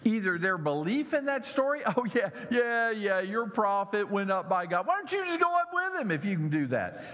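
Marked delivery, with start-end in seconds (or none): 1.8-7.0 high, sneering jeer, fast at the end; mocking taunt. Preacher voicing boys jeering at the prophet, mocking. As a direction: taunt him like a jeering kid. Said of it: "what they are mocking him about".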